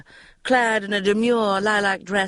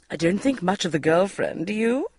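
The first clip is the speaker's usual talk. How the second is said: usual speaking voice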